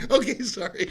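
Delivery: high, laughing, meek okay sorry; sheepish laughing apology. Retold or imitated. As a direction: give in to the wife, laughing. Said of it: "So I'm like".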